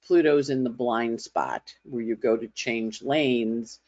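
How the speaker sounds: normal talking voice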